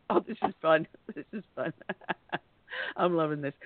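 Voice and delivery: real chuckles between low, tickled remarks; amused laughter. Real, not acted. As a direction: say it while giggling at the mishap. Host amused by on-air mishaps.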